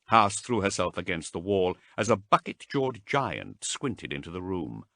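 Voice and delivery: usual speaking voice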